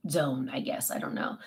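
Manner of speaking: whispered shrug about her own zone; trailing whisper. Real, not acted. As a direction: let it drift off into a whisper. Wanting to be left alone.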